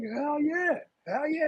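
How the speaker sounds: high excited hell yeah; hyped cheer. Retold or imitated. His own cheering in the quote.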